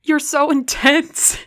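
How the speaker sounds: high, loud, slow through laughter; laughing. A narrator's reading. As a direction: laugh the words out. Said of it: "We laughed until our sides begged us to stop".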